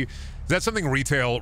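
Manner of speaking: usual speaking voice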